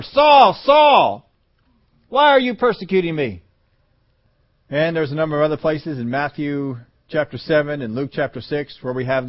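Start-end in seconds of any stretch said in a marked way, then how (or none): 0.0-3.4 high, loud confronting cry; loud call. Acted. Jesus crying out to Saul on the road, loud. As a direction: stop him in his tracks with a shout.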